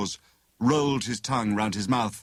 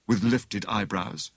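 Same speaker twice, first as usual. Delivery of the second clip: ordinary delivery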